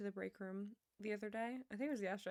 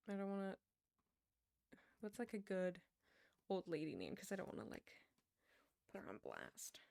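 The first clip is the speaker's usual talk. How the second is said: normal talking voice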